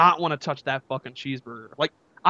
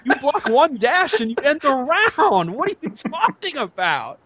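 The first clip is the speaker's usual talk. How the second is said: retold disbelief climbing higher; exasperated retort; 6 semitones higher than usual. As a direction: push back in rising exasperation.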